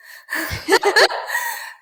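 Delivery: short amused laugh; light laugh. Real, not acted. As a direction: laugh at your own joke. Joke about her French accent.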